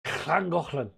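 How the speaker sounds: Llangollen with a strong throaty Welsh double-L; Welsh pronunciation. Shown as a demonstration. Trying to get the Welsh sound, Llangollen in exaggerated Welsh. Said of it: "trying to get the".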